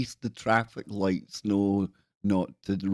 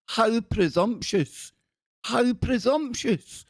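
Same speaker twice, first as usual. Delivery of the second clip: mock outrage; 12 semitones higher, slower than usual. Mock outrage at mermaid story.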